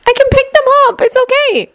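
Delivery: very high, fragile reassurance; on the verge of tears. A narrator's reading. Shinobu offers to tidy the cards, nearly in tears. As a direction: offer it while holding back tears. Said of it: "sounded on the verge of tears".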